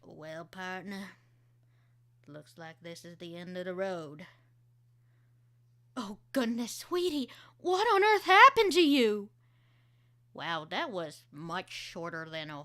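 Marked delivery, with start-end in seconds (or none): 0.0-4.3 low-key cowboy voice. Acted. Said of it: "my character demo here". 6.0-9.3 high, loud alarmed mother; fretting motherly voice; worried mom. Acted. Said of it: "my character demo here".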